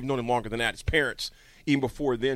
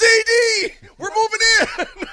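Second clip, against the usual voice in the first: very high, loud holler; excited retold shout; 13 dB louder, slower than usual. Friend calls back to host from the backyard, excited shouting. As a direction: holler the big news up to a window.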